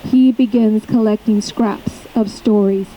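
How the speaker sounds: deliberate recital with weighted pauses; poem reading. Acted. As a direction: read it as a poem, pausing between phrases. Reads own poem aloud.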